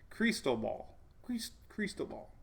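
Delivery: "crystal ball" with a long "ee" in the first syllable, crees; guide pronunciation. Shown as a demonstration. Practising the storm name from the guide, careful pronunciation. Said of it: "pronunciation guide".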